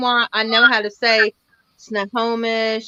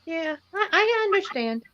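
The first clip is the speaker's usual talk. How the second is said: ordinary delivery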